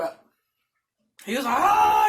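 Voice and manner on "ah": high, loud startled scream; waking up screaming. Retold or imitated. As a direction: scream like you were jolted awake. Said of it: "woke up screaming".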